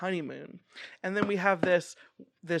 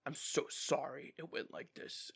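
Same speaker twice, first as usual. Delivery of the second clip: much softer, lower retold apology; hushed mock apology; 3 semitones lower, 11 dB quieter than usual. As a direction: drop to a soft, low apologetic voice. Acted hushed apology in retelling.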